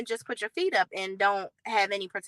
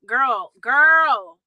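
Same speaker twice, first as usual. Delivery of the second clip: exasperated call; 2 semitones higher, 7 dB louder than usual. Real, not acted. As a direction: call her out like she's lost her mind. Calling out Jennifer's claim.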